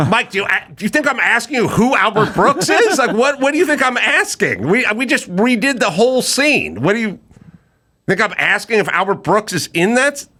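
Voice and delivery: high-pitched incredulous rant; exasperated. Retold or imitated. Teller challenges Mike over Albert Brooks, incredulous and exasperated. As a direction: replay your exasperation, pitch way up. Retold exasperated outburst at friend.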